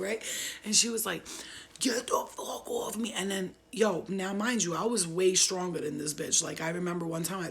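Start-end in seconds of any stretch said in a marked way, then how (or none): 1.8-3.1 breathy, growled screamo rasp; mock demonic man voice. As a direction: imitate her possessed growl. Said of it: "with a man voice".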